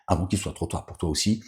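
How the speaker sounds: usual speaking voice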